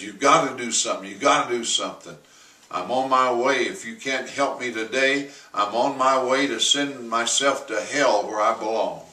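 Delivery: fast, loud, urgent begging; desperate plea. Retold or imitated. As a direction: press him for help before it's too late. Desperate George in pastor's office.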